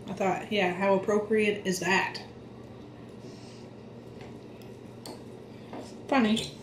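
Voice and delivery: talking between bites; wolfing down food. Real, not acted. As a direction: mouth full. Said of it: "I know I'm wolfing this down".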